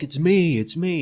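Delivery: high, insistent mock yell; retold yelling. Boyfriend trying to tell panicked girlfriend, retold yelling. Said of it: "like, yelling".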